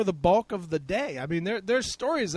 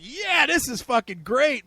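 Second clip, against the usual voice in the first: mock excited quote; 8 semitones higher, 6 dB louder than usual. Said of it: "He's like".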